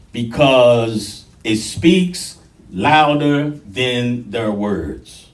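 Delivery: each phrase drawn out slowly with pauses; emphatic. Real, not acted. Preacher stressing that actions outweigh talk, emphatic. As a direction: stretch and weight every word. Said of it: "I'm putting emphasis on it".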